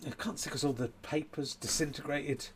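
ordinary talk, nothing marked

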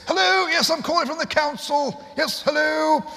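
high, loud put-on council caller; Steve Penk prank voice. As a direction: bellow it like a pushy official on the phone. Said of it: "where he'd say".

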